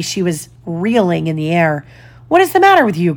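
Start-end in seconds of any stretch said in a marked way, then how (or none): 2.3-3.1 suddenly afraid, pitched well up; high, worried question; Peter's alarmed cry. A narrator's reading. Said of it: "cried Peter, suddenly afraid".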